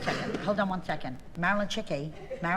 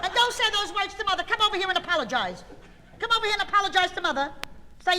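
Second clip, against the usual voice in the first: high-pitched, hurried demand for respect; scolding mother; 10 semitones higher, faster than usual. Acted. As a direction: order the child over, worked up and high. Scolds child, demands an apology.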